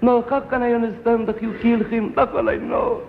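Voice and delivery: put-on high, pleading boy voice trailing off; a kid who won't understand; protesting boy. Son refusing his father's reasons, high and pleading.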